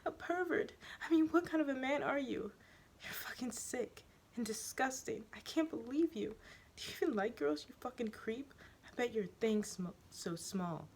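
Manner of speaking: high, shrill-pitched crying rant; tearful accusation. Acted. The student crying and insulting the teacher. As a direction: sob out the insults. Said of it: "she cries".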